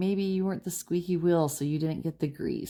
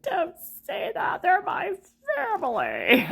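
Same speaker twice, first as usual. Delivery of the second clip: much higher, louder mock-defensive whine; mock protest; 6 dB louder than usual. The reader mimicking the viewer defending family, in a high mock whine. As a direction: squeal it like you're guarding your family. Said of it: "You're like".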